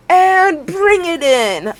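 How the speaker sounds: high, loud, drawn-out call; rallying call. Real, not acted. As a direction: stretch it out slowly, calling everyone in. Calling viewers into a hug.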